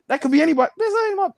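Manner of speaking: high, loud mock denial; dismissive denial. Comic voicing a man denying the baby, high scoffing. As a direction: brush off the claim. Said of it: "he's like".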